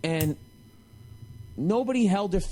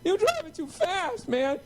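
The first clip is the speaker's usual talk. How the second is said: much higher, strained yelling; voice cracking; 12 semitones higher than usual. Retold or imitated. Yelling at the TV, voice pitched up in rage. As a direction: rant with the voice pitched up high. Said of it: "voice cracking".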